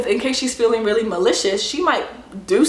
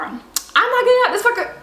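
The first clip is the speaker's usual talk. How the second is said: high, put-on whiny mimic; mock her refusal in a silly high voice; mock passenger voice; 12 semitones higher than usual. Passenger's refusal mocked again.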